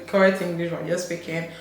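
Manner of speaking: normal talking voice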